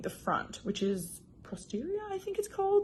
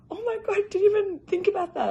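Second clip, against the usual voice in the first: high, louder astonished outburst; retold surprise; 12 semitones higher, 6 dB louder than usual. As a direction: act out suddenly realising, pitch leaping up. Acting her surprised reaction.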